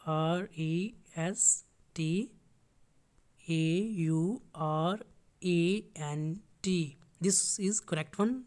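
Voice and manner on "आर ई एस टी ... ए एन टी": letters spelled out slowly, one by one; slow spelling out. Shown as a demonstration. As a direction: dictate each letter slowly and softly. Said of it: "correct spelling से यहाँ पे लिख देता हूँ मैं". (धीरे-धीरे एक-एक अक्षर बोलना)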